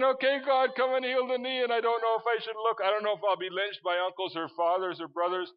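high, loud comic panic, easing toward the end; terrified. Retold or imitated. Preacher retelling his prayer over a stranger's knee, mock-terrified. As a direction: re-enact a frantic, frightened prayer. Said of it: "Because I'm terrified".

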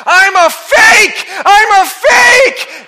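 slow, high, loud terrified screams; panicked yelling. Acted. Man in gorilla suit begging the lion, panicked yelling. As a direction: scream it for your life at the lion. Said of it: "the gorilla starts yelling".

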